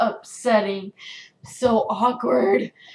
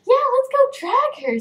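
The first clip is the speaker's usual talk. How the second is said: high, loud excited imitation; mock-eager squeal, much higher; eager friend; 6 dB louder than usual. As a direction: pipe up as the gleeful friend joining in. Acts friend eagerly joining the dragging.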